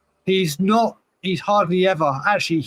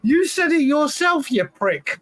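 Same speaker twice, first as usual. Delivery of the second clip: high-pitched indignant protest; excited protest; 9 semitones higher, slower than usual. Real, not acted. Cohost protests his friend's big-head joke, excited and high-pitched. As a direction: jump up in pitch, laughing disbelief.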